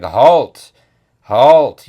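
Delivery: loud, mock-heroic order to stop; commanding halt. Woman acted commanding a stop.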